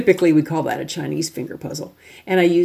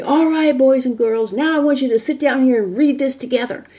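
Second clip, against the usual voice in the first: yelling teacher; 8 semitones higher than usual. Shown as a demonstration. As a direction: holler at the class like a mean teacher. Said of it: "I've been yelling at you".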